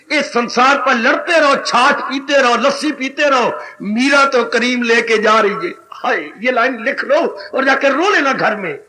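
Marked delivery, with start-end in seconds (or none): none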